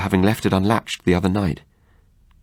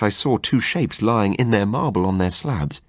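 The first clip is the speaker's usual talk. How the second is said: ordinary delivery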